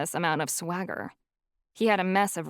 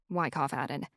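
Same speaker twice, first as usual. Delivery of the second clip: plain reading voice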